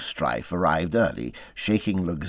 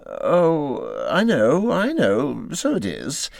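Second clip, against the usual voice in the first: evasive; 7 semitones higher, 3 dB louder, slower than usual. Acted. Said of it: "replied the Rat evasively".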